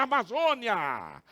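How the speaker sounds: loud, slow, stretched-out cry; gleeful shout. Retold or imitated. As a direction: call it back joyfully, drawing it out. His shouted reply to the officer. (grito alegre e prolongado)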